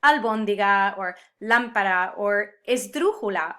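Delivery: al-BÓN-di-ga, LÁM-pa-ra, es-DRÚ-ju-la, punched loud; stress on third-to-last syllable. Shown as a demonstration. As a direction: hit the accented syllable in "albóndiga or lámpara or esdrújula". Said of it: "emphasis on the third to last syllable".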